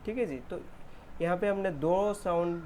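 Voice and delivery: ordinary delivery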